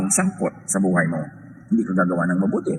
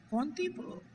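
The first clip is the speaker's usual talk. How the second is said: high, much softer sheepish "Konti po"; meek small reply; 14 dB quieter, slower than usual. Pretend. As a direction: answer shyly like a caught wife. Sister meekly answers pastor's question.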